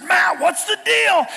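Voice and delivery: slow, loud, high exasperated call; exasperated shout. Retold or imitated. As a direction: shout it at the friend, drawn out. Calling out to anxious friend.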